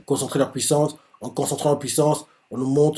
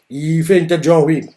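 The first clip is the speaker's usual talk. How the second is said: normal talking voice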